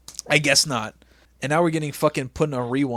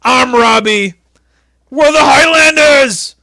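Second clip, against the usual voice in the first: slow, much louder and higher bellow; shouting in his ear; 13 semitones higher, 11 dB louder than usual. Retold or imitated. Imitating a Highlander yelling into the boss's ear, shouting. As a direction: yell it right into his ear. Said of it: "this is my cousin Robbie".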